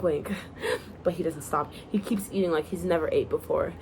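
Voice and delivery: plain reading voice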